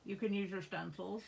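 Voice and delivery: talking around a pen cap; muffled by a pen cap in the mouth. Real, not acted. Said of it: "I have the pen cap in my mouth".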